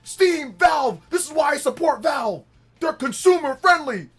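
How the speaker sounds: high, loud, ranting console-war fanboy; unhinged Steam fanboy. Acted. Streamer roleplays a Steam fan ranting at chat, high and loud. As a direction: rage at the chat like a crazed Steam superfan, cracking up. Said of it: "I gotta get into character".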